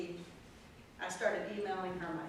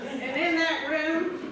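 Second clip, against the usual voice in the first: laughing; 8 semitones higher, 10 dB louder, slower than usual. Real, not acted. Still laughing after the quote.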